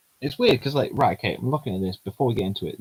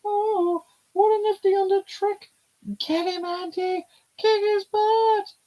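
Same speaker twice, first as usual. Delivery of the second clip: put-on much higher, girlish and excited; high character voice; 5 dB louder than usual. Voicing a girl who can't move her legs, high and alarmed. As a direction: play the startled girl, bright and high.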